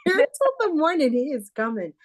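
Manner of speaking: high-pitched retold outburst, laughing into it; mock urgent protest. Mother telling the intern the baby is coming, mock urgent protest.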